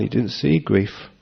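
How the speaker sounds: usual speaking voice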